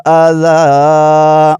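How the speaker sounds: slow, high, melodic tilawat; Quran recitation. Shown as a demonstration. Teacher reciting the passage for students, slow melodic chant. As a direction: chant the verse in long drawn melody. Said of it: "اگلا لفظ".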